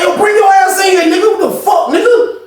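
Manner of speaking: high, heated imitated dare; retold furious summons, very high; acted angry challenge. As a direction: call him in to fight, pitch way up. Acts out challenge into the cell.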